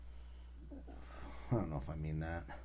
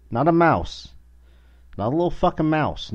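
louder and higher, outraged; exasperated outburst; loud indignant; 8 semitones higher, 8 dB louder than usual. Real, not acted. Indignant rant about rat size.

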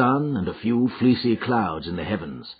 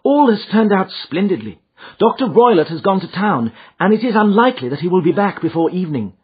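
put-on high, louder young lady's voice; excited, raised-pitch client; Miss Stoner, eager; 10 semitones higher, 6 dB louder than usual. A narrator's reading. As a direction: report good news in an eager rush.

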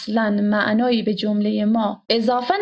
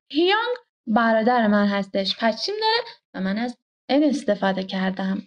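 ordinary delivery

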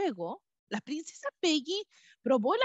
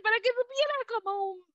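high bee voice; 10 semitones higher, faster than usual. A narrator's reading.

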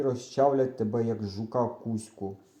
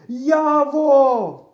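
high, long full-voiced shout; calling out loud; 13 semitones higher, 11 dB louder than usual. A narrator's reading. Boy calling his missing friend, shouting out loud. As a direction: shout the name across a field. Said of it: "кричу вголос".

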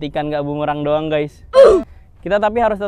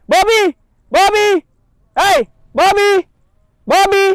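high, loud repeated calls; shouting the bird's name; 8 dB louder than usual. Real, not acted. Owner yelling for his bird flying too far, high and loud. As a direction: yell him back again and again. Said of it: "saya pasti teriak-teriak manggil dia".

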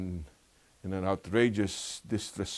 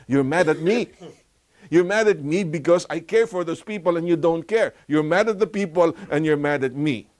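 louder, higher accusing voice; stern rebuke; 8 semitones higher, 6 dB louder than usual. Retold or imitated. Jesus accusing the Pharisees, raised stern rebuke. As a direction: confront them hard, voice raised. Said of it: "Jesus said".

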